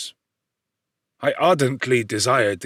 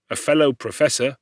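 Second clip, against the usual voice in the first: ordinary delivery, nothing marked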